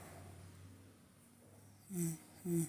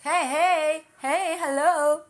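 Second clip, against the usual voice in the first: loud, high hey to noisy pets; raised, higher calling voice; 7 semitones higher, 6 dB louder, much slower than usual. Real, not acted. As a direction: call across the room, drawing out the last word. Calls out to yowling cats.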